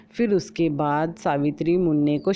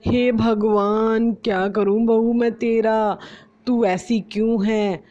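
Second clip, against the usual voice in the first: slow, higher despairing "हे भगवान"; exasperated sigh; 5 semitones higher, 3 dB louder than usual. Acted. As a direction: throw up your hands at the bahu. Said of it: "माथा पकड़ लेती है". (माथा पकड़कर धीमे से "हे भगवान")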